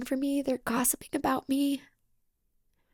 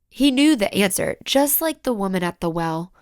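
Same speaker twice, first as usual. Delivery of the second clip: normal talking voice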